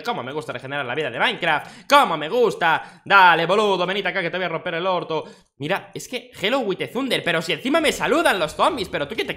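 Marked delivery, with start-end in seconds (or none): 3.0-5.2 mock Argentine threat, first words much louder; Argentine tough guy. Gamer threatening a zombie in a mock Argentine accent. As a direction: bark it like a porteño brawler.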